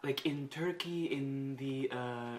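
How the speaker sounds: lightly imitating a celebrity's put-on foreign accent; mock [name] foreign accent. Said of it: "speak like a foreigner".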